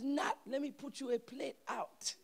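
imitated Haitian mother, pitched high; Haitian accent. Haitian mom offering her son a plate, high Haitian accent. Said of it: "with a Haitian accent".

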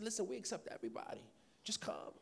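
soft, fast, reassuring near-whisper; gentle reassurance. Retold or imitated. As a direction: coax her in quietly. Gently reassuring shy woman.

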